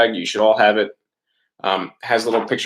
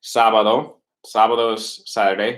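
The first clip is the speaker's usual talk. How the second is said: usual speaking voice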